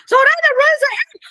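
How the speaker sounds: thrilled disbelief that Zoraida spoke up; shocked. Real, not acted. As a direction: squeal the news in surprise. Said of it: "I was, like, so shocked".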